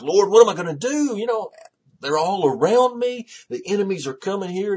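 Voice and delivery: high, loud cry to the Lord; distressed plea. Acted. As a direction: cry out as if surrounded. Voicing David's distressed cry to God.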